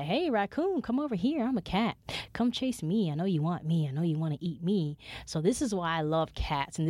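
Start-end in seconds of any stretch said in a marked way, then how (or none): 0.0-5.0 taunting cat. Retold or imitated. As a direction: lure the raccoon away. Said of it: "and was like".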